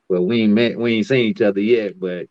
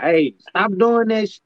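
mock scolding; 10 semitones higher, slower than usual. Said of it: "or you be like".

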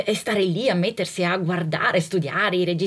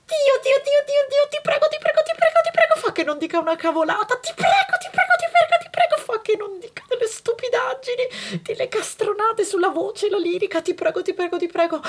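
frantic prayer; 13 semitones higher, faster than usual. Retold or imitated. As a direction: pray in a squeaky panic. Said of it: "faccio anche delle preghiere".

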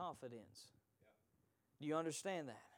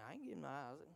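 a touch of a stubborn believer, softer; stubborn refusal; 3 semitones lower, 9 dB quieter, much faster than usual. Retold or imitated. Congregant Jessica refusing to give up her Isaac, mild mock grumble. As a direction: mildly mimic a clinging worshipper. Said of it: "Jessica back here saying".

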